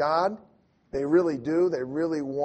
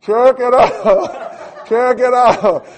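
booming slow megaphone call, laughing in between; drawn-out loudhailer chant with a laugh; megaphone shouting; 8 semitones higher, 15 dB louder, slower than usual. Retold or imitated. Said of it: "We go back to it".

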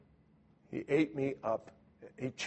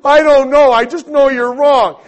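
much louder, much higher frustrated yell; angrier outburst; 11 semitones higher, 19 dB louder than usual. Retold or imitated. Cornered by the elder, frustrated yell. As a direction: yell it as you lose the argument. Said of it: "And I".

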